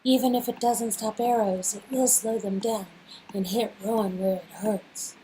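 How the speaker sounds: usual speaking voice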